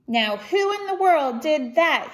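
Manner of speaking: a watchman hearing a crash in the dark; exclaimed. Acted. Night watchman hearing the crash, startled exclamation. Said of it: "he exclaimed".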